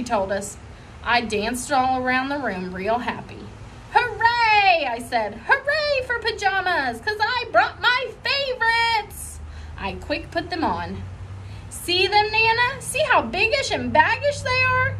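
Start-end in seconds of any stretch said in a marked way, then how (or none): 3.9-4.9 put-on high, bright child's cheer; excited cheer. Little girl cheering for her favorite dinner, excited. 5.5-9.4 excited. Acted. Junie gushing to Nana about dinner, high and gleeful. 11.7-14.9 put-on high, eager child voice; excited showing off. Junie showing Nana her baggy pajamas, high and eager.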